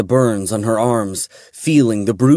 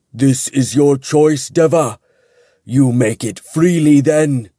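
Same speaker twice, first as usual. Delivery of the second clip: grave angel question; 4 semitones higher, 4 dB louder than usual. A narrator's reading. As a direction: give him one last chance. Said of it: "Cetheriel asked".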